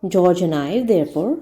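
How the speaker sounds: plain reading voice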